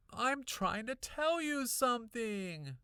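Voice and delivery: high, loud, slow shout; frustrated kid shouting at her parents. A narrator's reading. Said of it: "I shouted".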